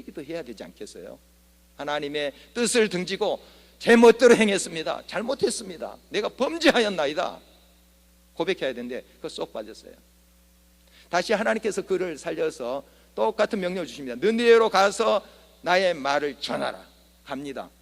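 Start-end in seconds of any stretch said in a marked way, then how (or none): 1.8-7.4 pleading confession. Acted. Acted confession prayer in sermon. 14.2-16.8 commanding divine voice. Acted. God's command quoted in sermon.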